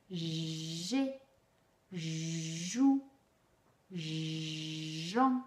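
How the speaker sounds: "ja", "je", "ji" said low and slow, the "j" held long; slow syllable drill with a long "j"; prolonged consonant syllables. Shown as a demonstration. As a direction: drag out the "j" before each vowel. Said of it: "on insiste, on prolonge, la consonne".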